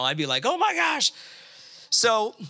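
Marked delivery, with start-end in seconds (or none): 0.4-1.1 high-pitched exclamation of shock; mock shock. Speaker imagining his own reaction to the bill, mock shock.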